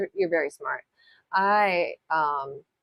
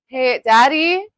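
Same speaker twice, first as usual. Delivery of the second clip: high, loud call across the house; 6 semitones higher, 8 dB louder, much slower than usual. Real, not acted. Calling Daddy from the nail desk, loud shout. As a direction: shout for Daddy from the next room. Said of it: "Sorry about the screaming in your ears".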